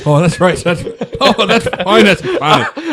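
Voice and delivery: high, breaking up with real laughter; words squeezed out between laughs; laughing. Real, not acted. Cracking up at his friend's Irish mum joke, laughing.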